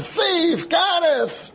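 two high, shouted cries; screamed accusation. Retold or imitated. Accuser to the cyclist before calling the police, screaming. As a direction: scream each word as an accusation. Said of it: "He starts screaming at him".

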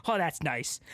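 ordinary delivery

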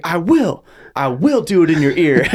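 hammed-up defiant retort, loud and high; mock defiant yell. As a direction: imitate a player loudly taunting back. Retold defiant yelling at opponent.

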